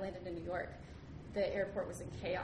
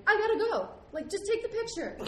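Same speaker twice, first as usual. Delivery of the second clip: high, loud acted urgency; retold frantic impatience; 12 semitones higher, 8 dB louder than usual. Rushed traveler to the photo lady, high and loud. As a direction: plead loudly to be let go.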